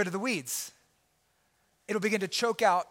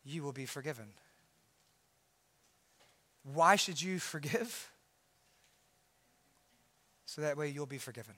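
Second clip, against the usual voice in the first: hushed sincere reading; 5 semitones lower, 5 dB quieter than usual. Acted. Pastor quietly pressing the verse on listeners, soft and sincere. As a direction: let each phrase sink in quietly. Soft reflective scripture close.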